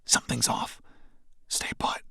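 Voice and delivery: tense unvoiced whisper; whispered warning while hiding. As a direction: hush it so the monster won't hear. Hiding from a giant scorpion.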